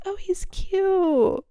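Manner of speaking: high, drawn-out swoon; retold gushing. Teller recalling first look at a match, gushing high. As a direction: coo it, stretching the last word right out.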